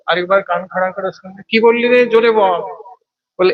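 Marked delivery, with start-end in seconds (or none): 1.5-2.6 demanding repeat. Acted. Scholar demands they repeat.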